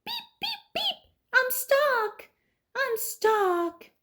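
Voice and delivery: very high toy-truck voice, pleading; stuck little truck. Acted. Reading aloud, Little Blue crying out stuck. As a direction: squeak it out in a panic.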